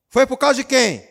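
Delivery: high, loud rhetorical question. Real, not acted. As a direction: challenge the congregation loudly. Pregador pergunta à igreja. (pergunta gritada)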